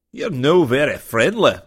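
Scottish engineer laying on his burr; strong Scottish burr. A narrator's reading. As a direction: roll it out in a thick Scottish brogue. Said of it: "his burr even more pronounced".

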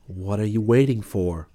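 insistent voice from within; an urging voice inside your head; inner voice. Acted. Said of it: "said the voice within me again".

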